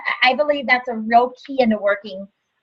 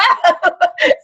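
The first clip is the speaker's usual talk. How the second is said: short laugh; 7 semitones higher, 4 dB louder than usual. Real, not acted. Laughs after script demo.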